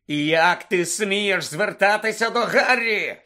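loud, drawn-out furious bellow; thundering indignation; enraged roar. Acted. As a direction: roar it in outrage, slow and high. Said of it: "заревів Блек".

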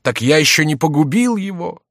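high, loud astonished protest; exclaimed surprise. A narrator's reading. Jester hears of Saint-Luc's survival, exclaiming in surprise. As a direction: exclaim with mock amazement. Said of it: "вскричал Шико".

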